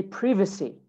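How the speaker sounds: British "privacy", first syllable as short "priv"; British accent. Shown as a demonstration. Teacher models British "privacy" for students, short I. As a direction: say "privacy" with the I of "give". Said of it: "In British English".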